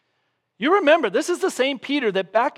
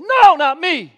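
high, loud angry denial; 8 semitones higher, 10 dB louder, slower than usual. Retold or imitated. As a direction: snap back furiously, cornered. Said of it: "he actually gets agitated and angry".

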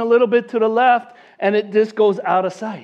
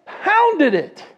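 "pounded" stretched out slowly on a high pitch; emphatic POUNDED; 9 semitones higher than usual. Retold or imitated. Teller marvelling at friend's huge drive, "pounded" high and drawn out. Repeated climax of the big drive.

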